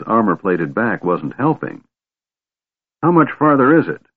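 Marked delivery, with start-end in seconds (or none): 3.0-4.0 louder, higher question; raised voice. A narrator's reading. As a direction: call it out over the march. Creature asks, loud.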